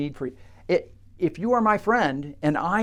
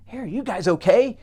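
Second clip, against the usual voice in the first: calling down; 5 semitones higher, 6 dB louder than usual. Acted. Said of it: "she says".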